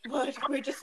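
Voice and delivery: usual speaking voice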